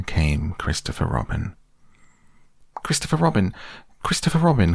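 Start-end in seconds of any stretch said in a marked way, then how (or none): 2.8-4.7 high desperate calls; crying out. A narrator's reading. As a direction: call out, pleading, high. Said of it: "cried Piglet".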